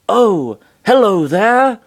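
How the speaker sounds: high, cheery put-on greeting; happy. A narrator's reading. Floating head greets everyone from the DS, happily. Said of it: "said happily inside the D".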